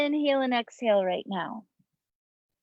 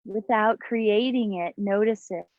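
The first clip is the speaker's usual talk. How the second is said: guided meditation. Acted. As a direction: guide them into the breath, unhurried. Leads breathing meditation over music.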